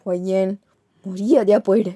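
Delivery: loud low threat; furious mother ordering her away; cold loud command. Acted.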